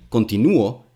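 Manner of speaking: "continúo" stressed on the syllable nú. Shown as a demonstration. Showing how stress changes a verb, middle stress on "continúo". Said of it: "changing the stress in a word".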